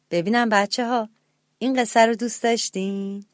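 ordinary delivery

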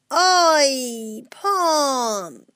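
stretched storybook yell of pain; cry of pain. A narrator's reading. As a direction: wail it slowly over a hurt leg. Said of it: "و داد زد".

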